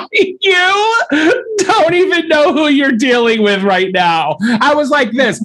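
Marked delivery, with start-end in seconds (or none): 0.1-4.4 mock outrage. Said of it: "And I was like".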